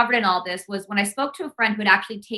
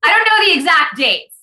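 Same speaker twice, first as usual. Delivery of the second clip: higher, louder mock protest; put-on voice, loudly pleading ignorance; Hillary in interview; 4 semitones higher, 5 dB louder than usual. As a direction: imitate an interviewee, pitched up and loud. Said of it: "Now I sound like Hillary".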